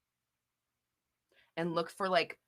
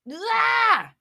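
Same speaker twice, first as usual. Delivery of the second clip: frustrated shout; 13 semitones higher, 4 dB louder than usual. Real, not acted. Frustrated outburst mid rant.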